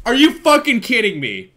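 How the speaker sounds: loud, high disbelief, falling at the end; incredulous outburst. Real, not acted. Streamer reacting to the Spanish translation, loudly incredulous. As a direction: explode in real astonishment.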